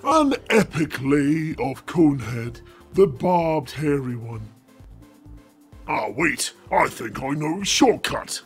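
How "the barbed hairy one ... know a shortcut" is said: usual speaking voice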